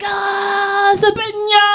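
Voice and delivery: high sung mock sneeze; mock African sneeze. Showing how an African sneezes, high sung mock sneeze. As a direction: sneeze with a high singing flourish. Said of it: "as an African, I was like".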